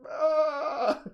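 sudden high, loud "aah"; mock yell from off camera; imitated scream. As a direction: cut in with a comic scream. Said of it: "you just hear".

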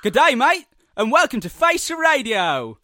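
loud, high bad Australian accent greeting; hammed-up Australian host welcome. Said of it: "not a good Australian accent".

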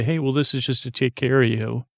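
ordinary talk

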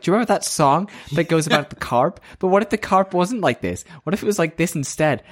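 high, chattering mock voice. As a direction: tease him in a sing-song prattle. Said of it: "she's mocking him".

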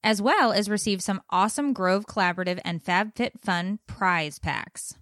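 quick, bright promo read; super fast ad read. Acted. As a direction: rattle off the contest rules. Sponsor contest read.

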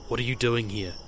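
soft, kept-down question. A narrator's reading. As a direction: ask it softly so nobody else hears. Hushed exchange by the dark pool.

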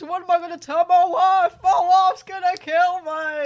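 loud hammed-up despair; mock panic. Fake victim fearing his wife's reaction, loud mock panic. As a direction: wail about the wife in mock dread.

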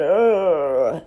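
loud ugh of disgust; disgusted groan. Real, not acted. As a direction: groan at the thought. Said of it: "terrible that is in my voice".

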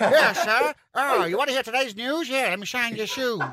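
put-on old New York shoeshiner. Host mocking co-host's idea of New York, hammed-up old New York accent. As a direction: play a chirpy old New York newsie. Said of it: "what you think New York is like".

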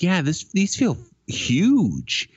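high, drawn-out mock amazement; incredulous customer. Customer complains to clerk about shoe size, incredulous. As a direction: imitate a baffled customer, stretching "huge" slowly.

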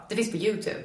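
ordinary delivery

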